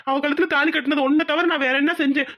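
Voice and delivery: ordinary talk, nothing marked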